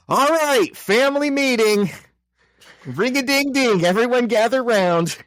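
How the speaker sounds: high, sing-out call to gather everyone. Acted. Aunt May summons her orphans to a family meeting, calling out.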